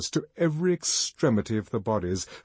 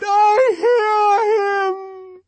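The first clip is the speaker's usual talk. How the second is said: very high, loud, long-held wail; ecstatic cry; 8 dB louder, much slower than usual. A narrator's reading. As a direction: cry it out, stretching every word. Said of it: "she cried".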